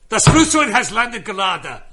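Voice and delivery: loud, high, forceful mock German; German accent. Shown as a demonstration. Comic showing off his party piece, shouted German accent. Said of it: "I can do a German accent".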